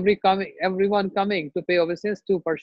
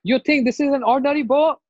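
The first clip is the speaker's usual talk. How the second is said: loud, high indignant question; acted wrathful scolding; angry rebuke; 8 semitones higher, 5 dB louder, faster than usual. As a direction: challenge them in outrage. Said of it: "you are showing so much anger".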